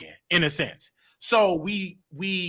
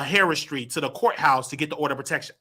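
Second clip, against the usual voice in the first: ordinary talk, nothing marked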